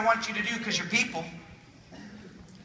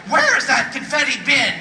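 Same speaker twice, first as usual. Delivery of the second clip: loud, high mock-indignant demand; hammed-up outraged question; upset; 5 semitones higher, 10 dB louder than usual. Audience member upset about the confetti, loud mock indignation. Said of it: "Some of you are very upset".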